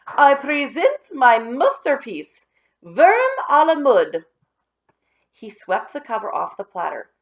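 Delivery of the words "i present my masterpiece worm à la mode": very high, loud, slow ringmaster call; announcer shout. Acted. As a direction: boom it out like a circus host. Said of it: "shouted Alan".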